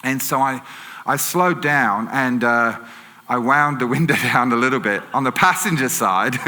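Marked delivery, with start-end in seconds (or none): none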